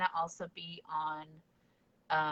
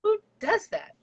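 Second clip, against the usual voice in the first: high, loud incredulous quote; mock disbelief; 9 dB louder, slower than usual. Coworkers teasing the homeschool kid, retold in loud mock disbelief. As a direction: imitate baffled teasing, pitched way up.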